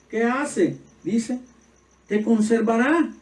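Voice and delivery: high, loud preacher's call; fiery preaching. Acted. Pastor proclaiming Scripture to the congregation, fiery.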